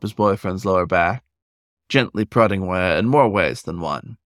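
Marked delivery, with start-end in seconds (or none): none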